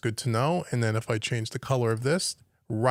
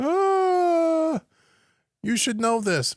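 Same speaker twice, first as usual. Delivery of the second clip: mock heckler; 14 semitones higher, much slower than usual. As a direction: jeer like an annoying commenter. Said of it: "someone's probably yelling at me going".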